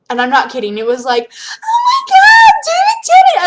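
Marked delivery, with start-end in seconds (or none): 1.6-3.4 shrieking high like a starstruck fangirl; fangirl scream. Retold or imitated. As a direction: scream his name in fan ecstasy. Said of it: "screaming like a bunch of fangirls".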